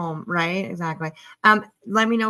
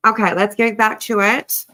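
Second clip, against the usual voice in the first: usual speaking voice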